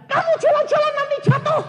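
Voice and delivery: high, loud, quick scolding; scolding wife. Acted. Wife warning her husband he will fall, high and loud. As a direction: nag him sharply, high.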